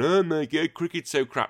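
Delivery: lightly hammed-up dismissive American; mocking Americans. Host mocks Americans sneering at cricket, mock whine. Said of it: "are gonna be going".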